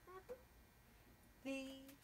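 usual speaking voice

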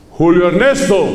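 mild mock stern call of the son's full name; lightly voiced strict dad summoning his son; stern father calling. Preacher imitating his father calling him by full name, mock stern.

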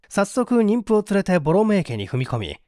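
plain reading voice

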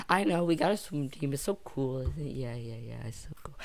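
quiet, dwindling aside; fading mock-modest aside. Mock-bragging aside, fading away.

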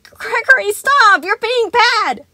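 very high, loud, stretched-out scolding plea; desperate pleading. A narrator's reading. Sun pleading with Gregory, high and loud. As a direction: beg and scold at once. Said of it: "pleading with".

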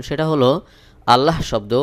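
normal talking voice

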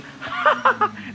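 high-pitched burst of laughter; laughing. Real, not acted. Gamer amused by a silly name, high laugh. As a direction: break up laughing mid-word.

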